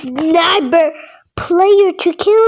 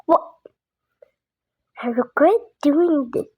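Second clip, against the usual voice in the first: usual speaking voice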